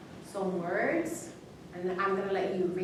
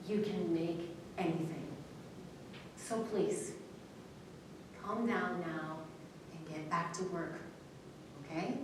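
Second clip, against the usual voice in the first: calm soothing reading; 5 dB quieter than usual. Shown as a demonstration. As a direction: read it gently to soothe the room, letting each pause land. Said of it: "So I would read it like this".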